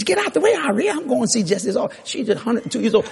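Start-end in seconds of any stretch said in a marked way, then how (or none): 0.0-1.9 much higher mock old-lady voice; high-pitched, comic retold order; impatient old woman. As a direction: play an old woman shooing her daughter aside. Retold old woman ordering her daughter aside.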